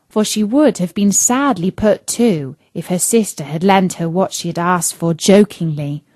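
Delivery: usual speaking voice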